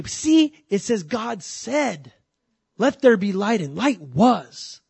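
high, slow mimicry of preachers leaning on "said"; mock-preach it, drawing out "said"; "said" stretched and stressed in a high, loud imitation; emphasis on SAID. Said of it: "they put the emphasis on the wrong word".

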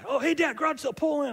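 put-on high, excited child voice begging dad; eager kid son. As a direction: play the little boy tugging at his dad to stop. Father retells son begging to stop.

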